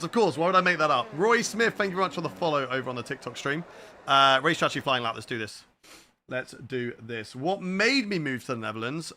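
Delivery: ordinary delivery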